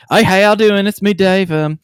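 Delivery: high, loud West Virginia phone greeting; imitating himself in broad West Virginia accent; thick home accent. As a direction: slip into a full West Virginia accent for the folks. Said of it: "as when I call my parents".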